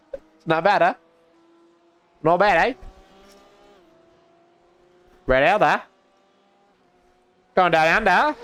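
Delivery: playful put-on Aussie accent; Australian accent. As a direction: try out an Australian twang. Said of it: "that's a pretty good accent".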